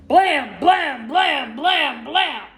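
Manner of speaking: loud, high repeated blams; banging noise. A narrator's reading. Knocking on the door at home, loud voiced banging. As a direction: pound out each knock with the voice.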